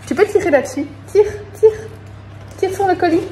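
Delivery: high, sing-song coaxing. Real, not acted. To a child helping open the parcel, high playful coaxing.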